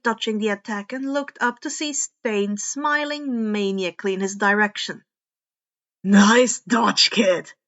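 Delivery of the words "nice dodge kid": lightly voiced villain, louder and lower; a hint of a taunting killer, pleased with himself; menacing villain. A narrator's reading. Stain taunting the young vigilante, lightly menacing. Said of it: "Stain said".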